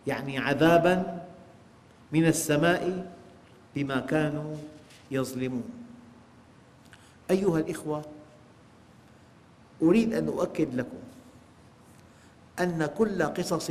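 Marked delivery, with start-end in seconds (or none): none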